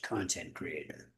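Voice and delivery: ordinary talk, nothing marked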